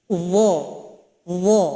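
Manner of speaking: "Dha" with tongue tip at the upper teeth, thick th sound; heavy dha. Shown as a demonstration. Teacher demonstrating the heavy dha to students. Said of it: "keluar dari ujung lidah".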